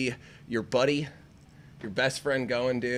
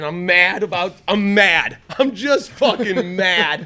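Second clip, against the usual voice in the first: mock mad; 4 semitones higher, 6 dB louder, slower than usual. Real, not acted. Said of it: "woke up today, like, mad".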